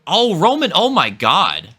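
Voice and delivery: high loud cry at the crash; excited shout. Real, not acted. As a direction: yell out at the big moment. Rider crashes on a send.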